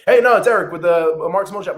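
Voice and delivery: loud, laughing mock pitch matching the caller; half-laughing loud intro; matching loud energy. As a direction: bounce his volume back at him. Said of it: "you have to match them, um, in tonality".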